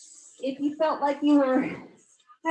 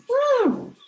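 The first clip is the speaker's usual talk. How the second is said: high loud woo; excited cheer; 13 semitones higher, 6 dB louder than usual. Real, not acted. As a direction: whoop of triumph. Cheer at sequence end.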